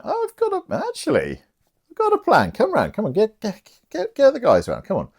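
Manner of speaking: excited schemer Maria. Acted. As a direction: bubble over with a naughty idea. Maria excitedly hatches a plan.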